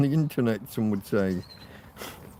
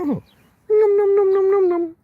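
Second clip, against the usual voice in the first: very high squeaky munching voice, put-on; voicing the dog; 4 dB louder than usual. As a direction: play the dog gobbling food.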